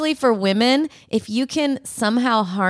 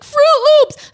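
put-on child begging; 8 dB louder than usual. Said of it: "And she's like".

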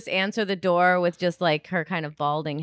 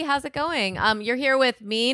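normal talking voice

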